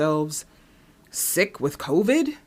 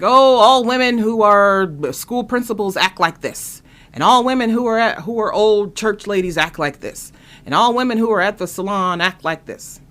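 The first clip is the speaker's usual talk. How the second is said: loud, raised-pitch parody; mocking comedians; 4 semitones higher, 6 dB louder than usual. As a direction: mimic a tired stand-up routine with exaggerated push. Mocking comedians' stereotype routines.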